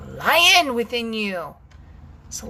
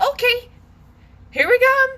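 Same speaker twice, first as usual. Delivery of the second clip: much higher, louder bursting voice; voicing your anger breaking loose; 13 semitones higher, 7 dB louder than usual. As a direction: let the trapped anger burst out high. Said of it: "So it said".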